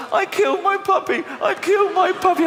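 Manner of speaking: put-on high whining kid voice; mock child wail. Child blaming herself for the puppy, high mock wail. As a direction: wail like a guilty little kid.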